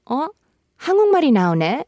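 imitating shocked mom. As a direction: play mom's startled phone reaction. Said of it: "my parents were shocked".

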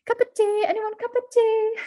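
imitating British flatmates in a lilting British accent; British friends. British flatmates coming home offering tea, mock British accent. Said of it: "my friends would come and be like".

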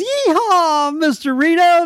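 high, whooping yeehaw; excited cheer. Real, not acted. Host celebrates Rito's sober days, whooping cheer. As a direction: cheer him on like a rodeo.

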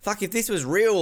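ordinary talk, nothing marked

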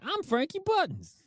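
high, drawn-out little character squeal; squeaky-high cartoon introduction, stretched slowly; Frankie Button voice. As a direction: pop out in a tiny, very high voice.

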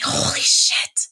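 awed whisper, drawn out slowly; stunned whisper. Retold or imitated. Realizing the list describes her, stunned whisper. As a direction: breathe it out in disbelief.

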